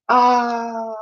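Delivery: long high cry of alarm. Acted. Elsie sliding down the chimney, crying out high. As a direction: cry out while sliding down fast. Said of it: "she cried".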